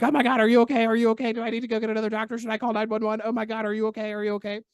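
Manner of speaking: high, rapid-fire retold panic, loud at first; mock panic. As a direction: fire off frantic questions without a break. Panics over his gasping mentor.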